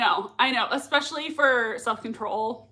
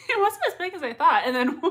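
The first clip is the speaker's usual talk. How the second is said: ordinary talk, nothing marked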